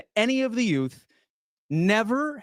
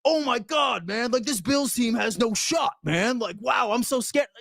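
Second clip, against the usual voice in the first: whiny, raised voice of a panicking doubter; mock scared fan; 3 semitones higher than usual. Mocking fans who panic about the Bills, whiny and high. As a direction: play the scared fan, over the top. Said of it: "just being like".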